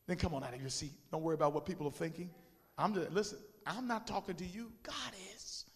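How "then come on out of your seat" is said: ordinary talk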